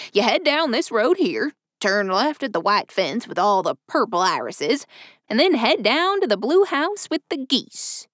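lightly voiced chatty old railroad man; a hint of a folksy old-timer; old station master voice. A narrator's reading. Station master gives directions.

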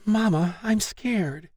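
put-on frightened little girl, very high voice; Noelle Piper. A narrator's reading. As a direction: whimper like a scared four-year-old. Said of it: "four-year-old Noelle Piper whimpered".

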